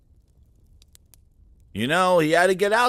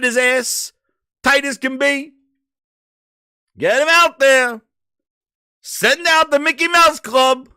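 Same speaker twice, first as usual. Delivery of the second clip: mocking rant; 7 semitones higher than usual. Real, not acted. Ranting, higher and louder.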